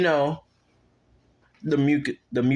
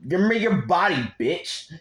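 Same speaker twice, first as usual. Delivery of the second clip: higher, louder villain demand, lightly voiced; a hint of a menacing monster, pitched up; lightly acted villain; 7 semitones higher, 4 dB louder than usual. Storyteller voices the sludge villain attacking Deku, lightly acted villain.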